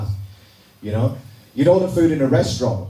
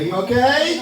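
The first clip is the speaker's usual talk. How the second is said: asked louder, pitched up high; 9 semitones higher, slower than usual. Shown as a demonstration. Comic asking the crowd to answer louder, raised voice. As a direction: call it out so the room answers. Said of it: "You can speak louder".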